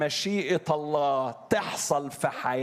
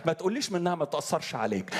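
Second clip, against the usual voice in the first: ordinary talk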